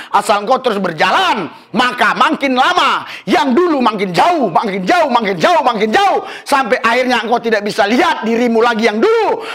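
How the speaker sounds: ordinary delivery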